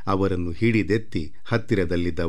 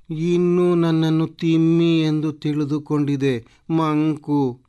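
slow, high-pitched grumble; peeved aside in a raised voice; inward grumbling; 7 semitones higher, 4 dB louder than usual. Acted. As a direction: grumble to yourself, drawing the words out. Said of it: "ಒಳಗೊಳಗೆ ಗೊಣಗುಟ್ಟುತ್ತಾ".

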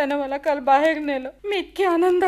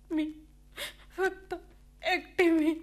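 quiet sob; 6 dB quieter than usual. Acted. Wife alone, breaking down.

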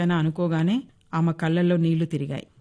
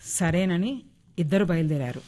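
ordinary delivery

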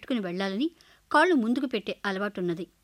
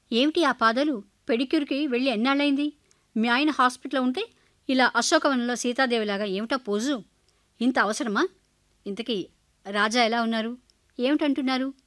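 louder, higher nagging mother; mother scolding her daughter, louder and higher; 3 semitones higher, 4 dB louder than usual. Acted. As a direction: tick her off for letting herself go.

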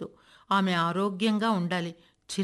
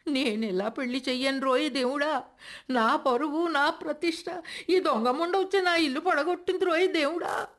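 high, wailing lament to God; wailing grandmother; 8 semitones higher than usual. A narrator's reading. Grandmother lamenting her lost honour, high wailing. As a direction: cry out to God in outrage. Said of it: "కంఠం తారస్థాయిని అందుకుంది".

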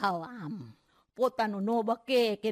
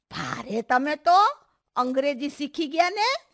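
smiling tease; 4 semitones higher, 7 dB louder than usual. Acted. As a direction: tease a friend with mock admiration. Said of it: "એણે મોઢું મલકાવ્યું ને બોલી". (મલકાતાં મલકાતાં મીઠી મશ્કરી)

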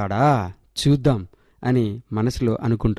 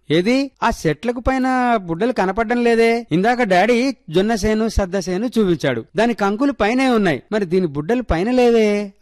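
put-on high, wide-eyed innocent girl's question; a puzzled city child asking naively; curious little girl; 8 semitones higher than usual. A narrator's reading. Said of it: "అమాయకంగా అడిగింది సుజాత".

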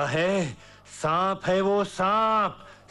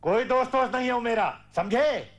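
fast, raised-pitch snap; angry denial; 5 semitones higher than usual. Acted. Father insisting he is no friend, angry outburst. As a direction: repeat it heatedly and quickly.

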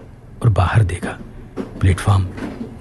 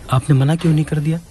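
higher and fast, anxiously scolding; fretful questioning tone; 7 semitones higher, faster than usual. Acted. Woman frets at the narrator for fetching water, fast and high. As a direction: rush the question out, half-reproaching. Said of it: "उसके पूछने के लहजे से".

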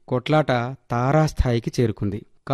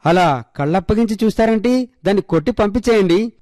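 high-pitched, half-raised cry of alarm; alarmed shout; 6 semitones higher than usual. Acted. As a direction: scold the onlookers, pitch up, without a full yell. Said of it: "Girija గట్టిగా అరిచింది". (గట్టిగా అరిచినట్టు)

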